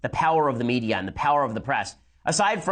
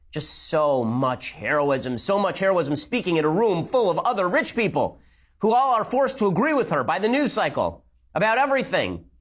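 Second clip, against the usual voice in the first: sarcastic mock awe; 5 semitones higher than usual. Sarcastic rant about heroism.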